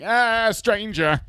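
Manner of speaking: high, loud, drawn-out mock scream; mock alarm. Imagined musician approached on the street, mock alarm. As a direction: cry out in hammed-up panic.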